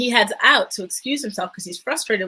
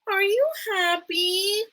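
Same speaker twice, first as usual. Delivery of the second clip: high, drawn-out childlike imitation; imitated sudden question; 11 semitones higher, slower than usual. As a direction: mimic her in a slow, high voice. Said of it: "she just all of a sudden asks him".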